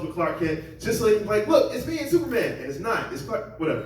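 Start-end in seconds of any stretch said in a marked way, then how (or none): 1.5-2.5 high, gushing fan showing off a photo; a fan thrilled with a selfie, high and gushing; excited fan voice. Retold or imitated. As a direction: squeal it like a starstruck fan.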